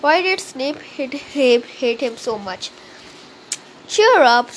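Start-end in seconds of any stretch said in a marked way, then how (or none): 4.0-4.5 louder, upbeat encouragement; loud cheerful. A narrator's reading. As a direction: cheer your friend on. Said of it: "said Ron".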